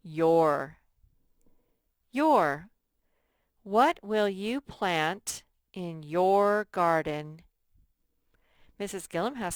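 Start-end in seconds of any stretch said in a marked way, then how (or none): 0.0-2.7 loud model word. Shown as a demonstration. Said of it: "This is what". 3.6-7.4 slow and louder, every word spaced; slow emphatic reading. Shown as a demonstration. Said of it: "teacher reads model sentence".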